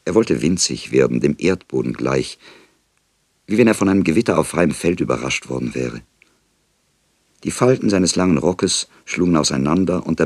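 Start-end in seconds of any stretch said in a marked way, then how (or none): none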